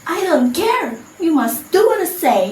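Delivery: high, careless brush-off; dismissive. Acted. Sinta brushes off her mother, high and careless. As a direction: toss it off like a spoilt girl. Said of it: "Sinta didn't care".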